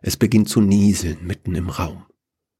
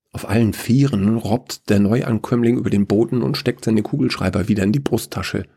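usual speaking voice

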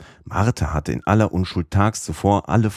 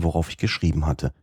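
plain reading voice

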